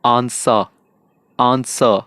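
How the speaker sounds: आंसर ending on an open a, r silent; non-rhotic answer. Shown as a demonstration. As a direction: drop the r in आंसर. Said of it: "अ की sound निकालो".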